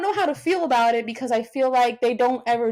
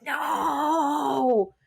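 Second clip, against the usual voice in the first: retold dismay; 4 dB quieter than usual. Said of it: "I was like".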